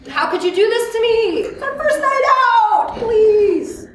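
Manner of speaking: high, drawn-out pleading whine; distraught parent. Acted. Mother overreacting to her crying baby in a restaurant, mock pleading. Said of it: "Now, I don't say".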